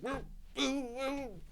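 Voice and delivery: high, strained little woo-woo-woo; a small boy wobbling under a big balloon; kid struggling. Retold or imitated. Teacher acting the boy hanging onto the balloon, high and strained.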